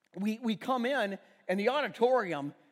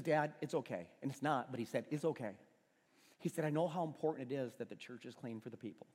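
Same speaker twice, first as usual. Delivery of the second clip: gentle reassurance; 6 semitones lower, 6 dB quieter than usual. Retold or imitated. Son forgives father gently.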